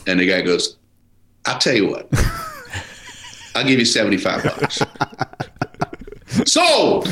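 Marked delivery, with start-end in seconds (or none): 1.5-4.8 imitated Southern mechanic, unbudging; Southern accent. Mechanic refusing to budge on price, Southern accent. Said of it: "a Southern accent". 6.5-7.1 sudden high-pitched punchline cry; exclaimed acceptance. Retold or imitated. Seller caving to a lowball offer, high exclamation.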